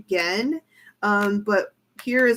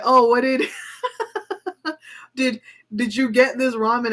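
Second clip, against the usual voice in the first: laughing through quote; 4 semitones higher than usual. Retold or imitated. Acting [name] bragging, laughs.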